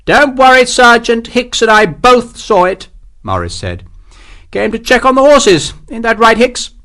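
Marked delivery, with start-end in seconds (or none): 0.1-2.9 high, loud, slow captain; clipped command from a captain; officer's voice. A narrator's reading. Captain reassuring the sergeant, high haughty officer voice. 4.5-6.7 clipped command from a captain; officer's voice. A narrator's reading. Captain reassuring the sergeant, high haughty officer voice.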